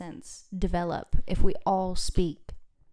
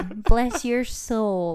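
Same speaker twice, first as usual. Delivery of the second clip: put-on Southern accent; 10 dB louder, much slower than usual. Mocking Southern speech.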